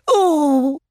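high startled little Oh; a tiny gasp of a word; speechless Oh. Acted. Piglet too overwhelmed to greet Christopher Robin, high startled Oh. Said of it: "the words wouldn't form themselves".

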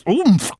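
high, muffled mouthful noise; munching mumble. A narrator's reading. Tigger munching a pawful of berries, muffled mumble. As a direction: grunt through a mouth full of berries. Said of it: "As he munched".

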